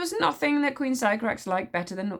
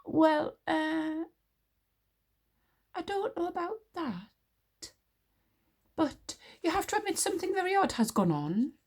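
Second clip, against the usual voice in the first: higher, flustered spluttering man; 10 semitones higher than usual. A narrator's reading. Witch smeller cowering before the queen, spluttering. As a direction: splutter it out, caught off guard. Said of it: "spluttered the witch smeller".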